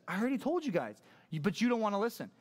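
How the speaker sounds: high-pitched, fed-up sass; sassy. Acted. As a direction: sass the questioners who won't listen. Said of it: "Jerry's pretty sassy at this point".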